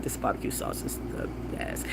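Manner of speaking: hushed whisper of delight; whispered rave. Real, not acted. Whispering to viewers about barbecue sauce. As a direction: whisper it like a secret.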